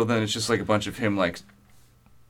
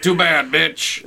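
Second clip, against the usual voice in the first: slow, deliberate taunting dude; slow mean dude voice; 3 semitones higher, much slower than usual. Retold or imitated. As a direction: drag it out like a jerk. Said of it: "Kicking and screaming like".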